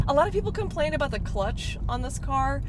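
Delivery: ordinary delivery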